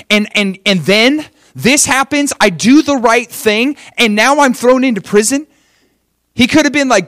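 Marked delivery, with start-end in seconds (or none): 0.1-5.5 higher, indignant protest; acted grievance. Imagined Joseph protesting to God from prison, indignant. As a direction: build an aggrieved complaint, pitch climbing.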